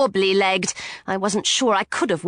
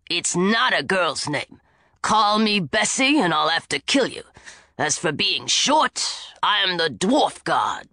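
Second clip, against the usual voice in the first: grumpy dwarf god; 6 semitones lower than usual. A narrator's reading. Dwarf chauffeur scolding the girls, gruff and low. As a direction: growl it out like a touchy dwarf.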